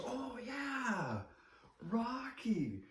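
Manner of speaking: very high, drawn-out imitated recognition; delighted recognition. Restaurant owner remembering Rocky, imitated high delight. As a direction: mimic someone suddenly remembering an old pal, way up high.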